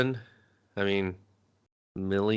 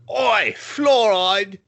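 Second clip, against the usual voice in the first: high, drawn-out Cockney bellow, easing off; hammed-up, stretched Cockney insult; mock Cockney accent; 10 semitones higher, 5 dB louder, much slower than usual. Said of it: "was a little cockney in there".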